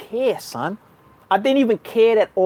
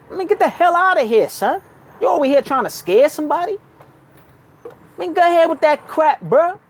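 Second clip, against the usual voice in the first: mock telling off; 7 semitones higher than usual. Telling off the spirit creature.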